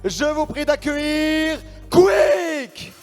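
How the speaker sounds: slow, high, drawn-out introduction; ring announcer. Acted. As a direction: stretch the welcome like a ring announcer. Introduces the second player.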